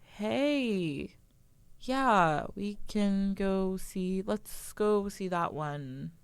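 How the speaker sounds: slow, drawn-out monotone guy; slow boring voice. Retold or imitated. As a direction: imitate a dull date dragging each word out. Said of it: "It was the slow voice where he would be like".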